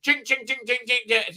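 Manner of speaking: imitated chipping noise. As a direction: mimic repeated taps on stone.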